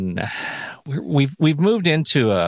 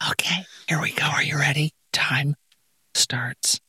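soft whispered countdown; 4 dB quieter than usual. Real, not acted. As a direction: hush the start of the round like a secret. Host whispering round start.

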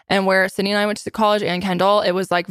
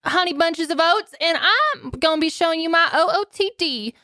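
put-on much higher, sing-song rush girl; mock sorority girl; 8 semitones higher than usual. Host mocks a Bama Rush girl's outfit video, much higher. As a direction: play the bubbly rush-video girl showing off her outfit. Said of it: "the one where it's like".

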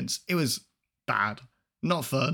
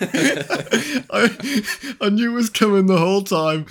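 laughing hard, higher and louder; 9 semitones higher, 8 dB louder, slower than usual. Real, not acted. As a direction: talk through the laughter. Laughing after host's punchline.

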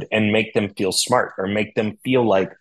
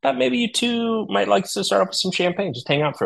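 bright host greeting; 11 semitones higher than usual. Retold or imitated. Greeting angry guests with champagne.

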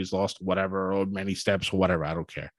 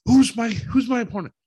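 high, loud mock-excited demand; freaking out, excited; 12 semitones higher, 5 dB louder than usual. Wrestler asking his boss about his opponent, freaking out. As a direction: imitate an overexcited wrestler begging to know. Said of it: "starts freaking out and all excitement".

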